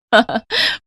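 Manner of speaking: short rising laugh at the bride; teasing chuckle; mocking giggle. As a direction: giggle you can't hold back. Sister-in-law laughs at bride.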